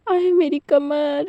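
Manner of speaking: slow, drawn-out groan of back pain; mild cry of pain. Acted. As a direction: stretch it out like a sore back. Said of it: "ऐसा कह के चिल्लाना start कर देता है". (धीमी, खिंची हुई कमर दर्द की कराह)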